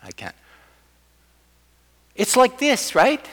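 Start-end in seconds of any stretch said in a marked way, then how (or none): 0.0-0.3 weary giving up. Retold or imitated. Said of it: "I'm like".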